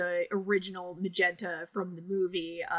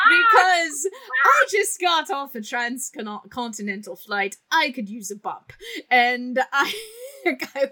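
words pushed out through laughter; can barely get it out for laughing; shrieking with laughter mid-sentence; laughing while talking; 6 semitones higher, 9 dB louder than usual. Real, not acted. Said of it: "laughing through the story".

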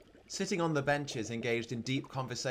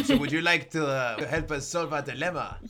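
put-on comic French-accented goblin; 2 semitones higher, 3 dB louder than usual. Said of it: "this voice is actually really annoying".

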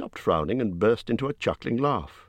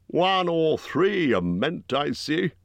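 higher, slow big-man voice; gruff Bjorn; 9 semitones higher, slower than usual. A narrator's reading. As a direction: voice Bjorn as a blunt giant host.

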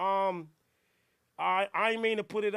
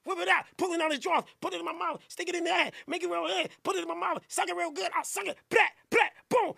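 mocking aggressive rapper; 8 semitones higher, faster than usual. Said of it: "You too aggressive".

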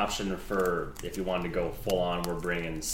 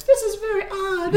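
squeaky rubber duck; 7 dB louder than usual. Acted. Shrunk horse speaks, tiny voice.

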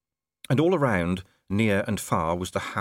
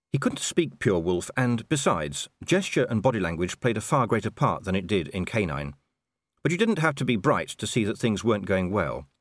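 ordinary delivery

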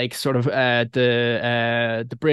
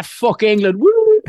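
mock sports cheer; 8 semitones higher, 5 dB louder, slower than usual. Mock Irish sports chant.

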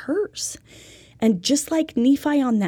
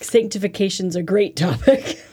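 said through a laugh; 4 dB louder than usual. Real, not acted. Co-host teasing the exciting topic, laughing. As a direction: chuckle through the line.

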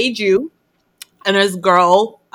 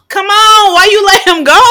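higher and louder pleading with the screen; exasperated protest; 10 semitones higher, 5 dB louder than usual. Real, not acted. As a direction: yell at the hero in frustration. I'm like.

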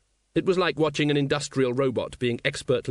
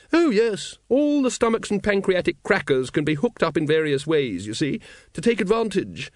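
high, loud lady's voice, explaining eagerly; hearty high-voiced woman sharing her expertise; keen lady dragon expert; 7 semitones higher, 4 dB louder than usual. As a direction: pitch it well up and lecture enthusiastically.

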